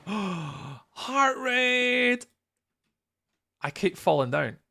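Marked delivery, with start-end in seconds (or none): 0.1-2.2 excited gasp. Real, not acted. Raid arrives, excited greeting.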